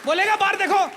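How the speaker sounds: mock scolding. Scolding the cheeky puppet.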